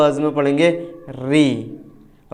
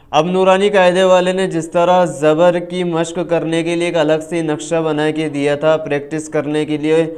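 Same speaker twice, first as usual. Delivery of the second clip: usual speaking voice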